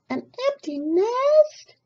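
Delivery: very high, slow hen question; a startled hen asking; surprised chicken. Acted. Chicken asks about the empty nest, high and slow. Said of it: "cried the chicken".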